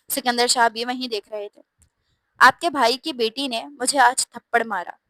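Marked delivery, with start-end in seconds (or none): none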